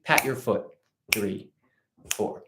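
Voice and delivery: ordinary delivery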